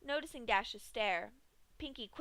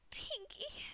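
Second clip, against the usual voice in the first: whisper; 6 dB quieter than usual. Acted. Broken Dash calls weakly.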